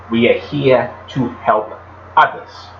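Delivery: lightly imitated Massachusetts accent, r's dropped; a gentle Massachusetts accent, "others" as "uhthuhs"; thick Massachusetts accent. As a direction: quote the old principal in his Boston-area accent. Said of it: "in his thick Massachusetts accent".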